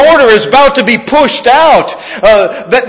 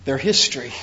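hushed pronouncement; 10 semitones lower, 12 dB quieter, faster than usual. Acted. Preacher quietly voicing Jesus's prophecy to the disciples, hushed and low. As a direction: drop low and soft for a grave verdict.

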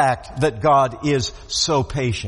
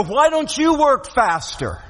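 higher-pitched imitation of an impatient complaint; mock impatience; 6 semitones higher than usual. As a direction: voice the people's impatient plea to God. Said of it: "our impatience with his patience".

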